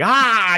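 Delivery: sudden high mock yell; short loud mock shout. Grasshopper boss startling the ants, mock yell. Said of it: "he's like".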